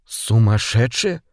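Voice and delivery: slow, stunned question; dumbfounded. A narrator's reading. Hugh echoes the captain's insult, stunned and slow. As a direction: barely get the word out, slowly. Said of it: "Наконец ему удалось произнести".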